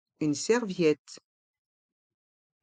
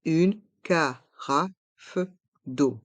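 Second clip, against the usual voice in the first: "Une carafe d'eau" in slow, separate syllables; slow model pronunciation; much slower than usual. Shown as a demonstration. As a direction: pause between syllables, releasing the f of "carafe". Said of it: "Prononçons".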